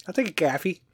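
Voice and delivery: Chicago "caffee", flat A vowel; reply in a put-on Chicago accent; hammed-up Midwestern Chicago answer. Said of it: "you know, Chicago accent".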